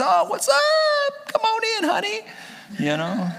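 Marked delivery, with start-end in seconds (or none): 0.0-2.2 excited king. Acted. King welcoming Queen Esther, excited. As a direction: greet her in a high, comic squeal. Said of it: "He's excited to see her".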